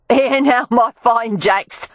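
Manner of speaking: high, slow Cockney call; Cockney accent. A narrator's reading. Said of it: "called out in a Cockney accent".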